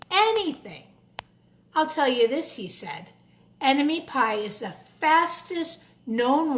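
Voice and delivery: put-on lively storybook narration for kids; a children's book read aloud. A narrator's reading. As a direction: read it like story time in class. Reads picture book to children.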